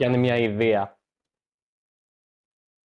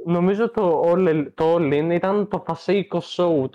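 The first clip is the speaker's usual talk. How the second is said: loud teasing; 6 semitones higher, 5 dB louder than usual. Real, not acted. Ribbing his co-host about the All In pick, loud and higher. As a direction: rib him with a loud, higher voice.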